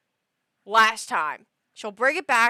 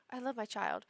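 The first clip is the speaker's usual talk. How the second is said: softer, subdued quote; imitating regretful parents; 9 dB quieter than usual. Regretful parent prefacing complaints about their child, subdued imitation. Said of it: "they're always like".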